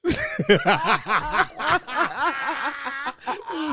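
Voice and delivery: long, high laughing fit; delighted laughter. Real, not acted. Co-host cracking up at Cool Runnings impression, delighted laughter. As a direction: laugh hard, pitched high.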